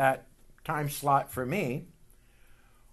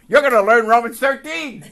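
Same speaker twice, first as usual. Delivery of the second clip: louder, laughing as he says it; laughing tease; 8 semitones higher, 13 dB louder than usual. Retold or imitated. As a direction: tease him through a laugh. Teasing the brother, laughing.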